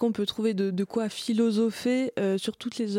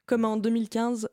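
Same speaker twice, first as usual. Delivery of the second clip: normal talking voice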